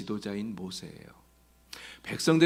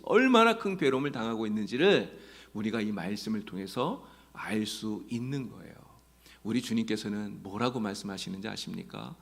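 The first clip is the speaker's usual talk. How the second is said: ordinary talk, nothing marked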